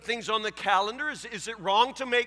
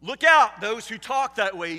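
loud, sharp warning shout; a preacher's booming warning; warning cry; 8 dB louder than usual. Retold or imitated. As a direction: call out the warning like a prophet. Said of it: "he says".

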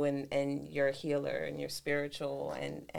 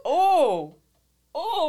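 impressed oh; 12 semitones higher, 11 dB louder than usual. Real, not acted. Reacting to friend's story.

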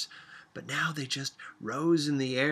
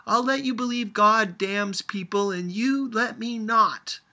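loud, higher indignant shout; shouting back; 10 semitones higher, 9 dB louder than usual. A narrator's reading. Grandmother shouting back at Sophia in a quarrel, loud and high. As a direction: fire it back at the child. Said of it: "her grandmother shouted back".

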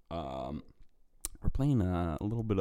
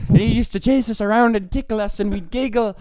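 high, loud giggly recollection; mock nostalgic glee; giddy high excitement; 10 semitones higher, 7 dB louder than usual. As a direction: gush about it like a giddy kid. Giddy retelling of tickling.

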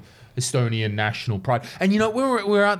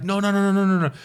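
protesting no; 7 semitones higher, faster than usual. Retold or imitated. Retold protest.

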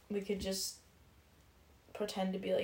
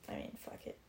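ordinary delivery

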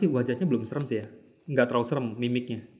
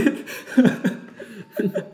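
high breathy laugh; 8 semitones higher than usual. Real, not acted. Laughs at scary story.

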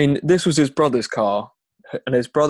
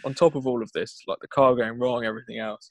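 ordinary talk

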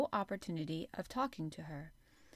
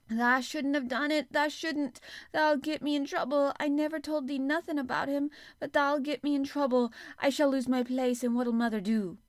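higher, louder, pleading in Yorkshire dialect; half crying; 7 semitones higher, 6 dB louder than usual. A narrator's reading. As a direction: beg her, close to tears. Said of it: "she said, half crying".

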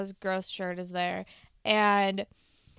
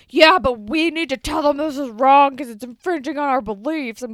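higher, louder sanctimonious lecture, dragging out the blahs; mock preachy Linus; 4 semitones higher, 9 dB louder than usual. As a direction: ham up a self-righteous bully's sermon. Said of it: "Linus is like".

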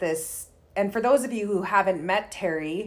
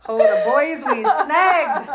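slow, louder, laughing through it; 7 semitones higher, 7 dB louder, slower than usual. Real, not acted. Recalling the station wagon days to host, laughing. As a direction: laugh it out fondly, drawn out.

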